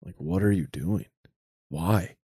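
subdued imitation of a crying dad; sad dad crying. Dad pleading with his kid, understated sad crying. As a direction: plead quietly, a touch of dad's sorrow. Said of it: "It's sad dad crying".

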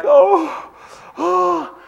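put-on high, whiny little boy voice; mock child whimper. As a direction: whimper like a kid still upset. Imitating crying little George.

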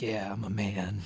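half-joking private boast; whispered boast. Retold or imitated. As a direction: mutter your pride to yourself. Proud muttered boast in shower.

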